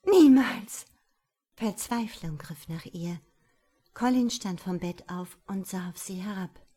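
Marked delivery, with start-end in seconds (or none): 0.0-0.8 very high, very loud refusal; Shirley screaming her refusal; defiant scream. A narrator's reading. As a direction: scream it at him with all you have.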